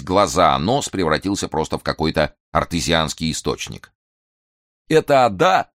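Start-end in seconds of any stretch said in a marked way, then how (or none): 4.9-5.7 blocked nose, wheezing it out; sniffly hoarse question; nasal wheeze. Acted. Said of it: "гнусавым голосом просипел Фандорин".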